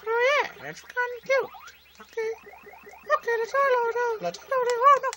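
very high falsetto; little squeaky voice. Pretend. Player as the game character chatting mid-play, little squeaky voice. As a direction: pipe it up in a tiny squeak. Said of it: "I go into the little squeaky voice".

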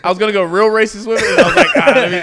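very high and loud, cracking up; helpless laughing. Real, not acted. Guest confessing his joke plan, helpless laughing. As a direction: squeal it out through real laughter.

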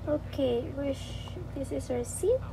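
ordinary delivery

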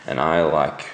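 ordinary talk

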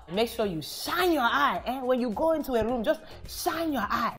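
auntie's warning. Retold or imitated. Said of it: "what every Nigerian auntie ... tells you".

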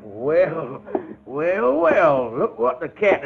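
loud, high mocking well, well; gloating. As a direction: savor the catch.

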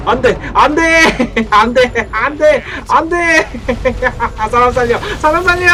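high laughing screams of panic; panicked screaming. Real, not acted. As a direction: shriek no, no, help. Screaming while chased by monster.

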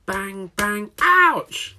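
high yelped ouch after bangs; loud bang and ouch. A narrator's reading. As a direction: bang then cry out. Workshop noises with ouch.

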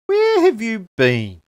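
high, loud, slow complaint; hungry koala nagging the tree; impatient koala. Acted. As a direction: demand to know why she's late.

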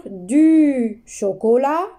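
"Du chocolat" drawn out, then the words rising; teacher modelling the reading; slow reading. Shown as a demonstration. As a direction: read the list slowly for the class. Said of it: "Je répète ici la lecture".

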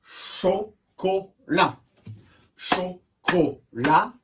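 very slow "Chocolat" in three beats; syllable by syllable. Shown as a demonstration. Teacher clapping a word with pupils, syllable by syllable. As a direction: pull "Chocolat" apart into Cho, co, lat. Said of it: "Un, deux".

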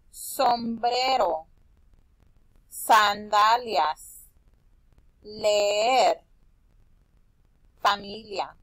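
sombrero, sandalias, leer, familia, each stretched out slowly; slow word modelling. Shown as a demonstration. Teacher asks children to listen, slow drawn-out words. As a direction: draw out every word, pausing between them. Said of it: "quiero que escuchen estas palabras".

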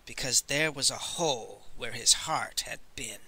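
usual speaking voice

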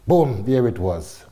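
normal talking voice

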